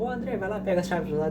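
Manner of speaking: voz mais aguda de quem pede algo; pedido encenado. Acted. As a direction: imite de leve um colega pedindo um favor, em tom mais agudo. Alguém pedindo as chaves a ele. (pedido encenado em voz aguda)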